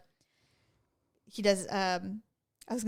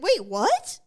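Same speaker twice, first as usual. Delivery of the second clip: higher, louder startled double take; shocked disbelief; 7 semitones higher, 6 dB louder than usual. Retold or imitated. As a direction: kid suddenly alarmed by the news. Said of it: "Like, he was freaking out about it".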